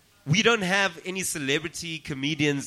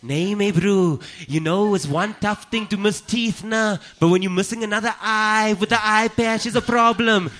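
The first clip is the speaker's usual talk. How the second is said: put-on slow, broad Cape Flats colored accent; hammed-up colored South African accent, drawn out; 5 semitones higher, 3 dB louder than usual. Colored accent for the eye patch joke, hammed-up and slow. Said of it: "this is a colored eye patch".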